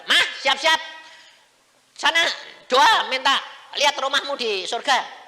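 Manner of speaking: high, loud call to Mama; urgent command. Retold or imitated. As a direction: order it briskly at full volume. Menyuruh dengan seruan keras. (perintah diserukan keras)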